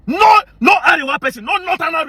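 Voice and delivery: sudden high furious shout; angry shout. Real, not acted. Ranting at the Arewa youths online, furious shout. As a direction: blast it at them in outrage.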